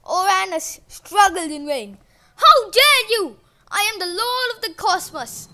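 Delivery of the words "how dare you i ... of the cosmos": loud, high outrage; outraged shout. A narrator's reading. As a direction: roar with wounded pride. Uranus outraged.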